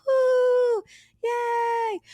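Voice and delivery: high, whooping cheer fading off; mock crowd cheer. Imitating the crowd going wild as the DJ starts, high whoop.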